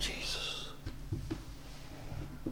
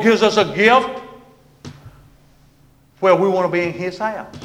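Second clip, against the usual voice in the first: loud, raised sermon voice; preaching; 7 semitones higher, 12 dB louder than usual. Real, not acted. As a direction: preach it out, high and forceful. Preaching at full force.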